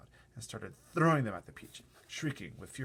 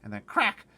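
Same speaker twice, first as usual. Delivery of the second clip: crack sound; 12 dB louder than usual. Acted. Imitating hail crack.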